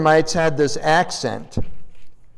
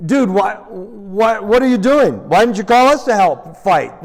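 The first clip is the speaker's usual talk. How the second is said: mock indignant protest, higher and louder; indignant complaint; 4 semitones higher, 5 dB louder than usual. Tribe of Ephraim confronts Jephthah after the victory, mock indignant. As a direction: act out an offended tribesman.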